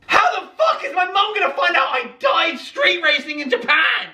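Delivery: high, shouted panic; screaming in mock terror at the thought; panicked shout. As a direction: yell the thought as if about to die.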